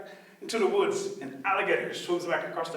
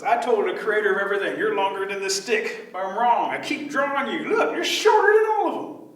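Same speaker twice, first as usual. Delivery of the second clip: higher, louder, indignant rabbit; exasperated rabbit building to a high pitch; fussing rabbit; 4 semitones higher, 5 dB louder than usual. Acted. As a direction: argue your case, climbing to a squeal.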